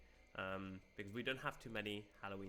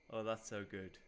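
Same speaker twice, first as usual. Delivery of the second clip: normal talking voice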